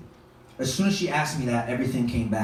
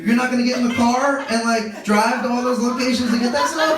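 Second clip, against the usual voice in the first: stoned explaining; 8 semitones higher than usual. Retold or imitated.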